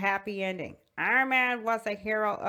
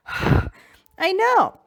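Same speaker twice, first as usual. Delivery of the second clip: ordinary talk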